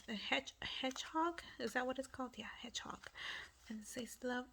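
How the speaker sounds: soft unsure whisper. Real, not acted. Crafter naming the hedgehog, whispering to herself. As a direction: check the name under your breath.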